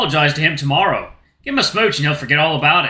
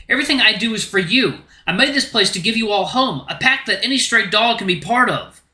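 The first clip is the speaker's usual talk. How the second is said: raised, heated protest; angry outburst; 4 semitones higher than usual. Acted. Luke to Rune about being left out of the pack, angry and raised. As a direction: let the hurt boil over into anger.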